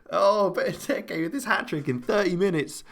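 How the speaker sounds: high-pitched, thrilled commentary; delighted goal reaction. Real, not acted. Commentator marvelling at a hat-trick in thirty minutes, delighted.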